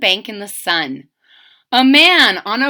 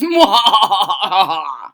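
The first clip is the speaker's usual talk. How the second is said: evil gloating chuckle; hammed-up baddie laugh; villain laugh; 5 dB louder than usual. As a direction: cackle over the captured crocodile. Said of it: "he added".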